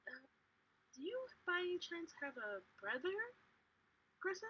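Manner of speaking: put-on friendly adult asking a child; sweet questioning voice. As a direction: gently probe the girl. [name] asks the girl.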